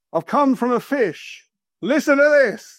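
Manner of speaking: high, drawn-out proclamation; prophet proclaiming. Acted. Seaweed-covered Jonah to the Ninevites, proclaiming. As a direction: call it out like a strange messenger from the sea.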